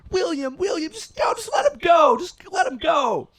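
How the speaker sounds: full-volume, high, pleading yell; mom's frantic shouting. Retold or imitated. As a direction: imitate a mother screaming to break up a fight. Imitates mom intervening outside.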